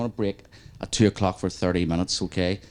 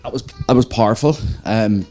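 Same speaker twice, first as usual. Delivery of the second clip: steadying shaky voice; 3 semitones higher, 8 dB louder than usual. Real, not acted. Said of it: "sorry, I'm getting emotional".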